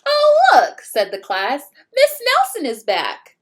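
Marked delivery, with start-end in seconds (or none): 0.0-0.8 very high, loud delighted cry; overjoyed class spotting their teacher; thrilled kids. Acted. Class excited at return. 1.9-3.4 very high, loud delighted cry; overjoyed class spotting their teacher; thrilled kids. Acted. Class cheering teacher's return.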